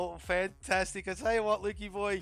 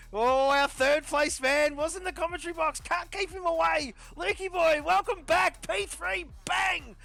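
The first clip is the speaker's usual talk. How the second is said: high-pitched, loud sportscaster welcome; big excited podium introduction, pitched well up; hyped-up announcer intro; 9 semitones higher, 4 dB louder than usual. Acted. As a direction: bring the driver on like a ring announcer. Host hypes up returning driver.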